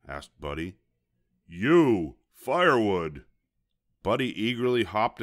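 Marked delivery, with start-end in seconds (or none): 1.5-3.2 loud, impatient varmint bark; barked order. A narrator's reading. Varmint ordering bunny to fetch firewood, barking. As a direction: bark each word out hard.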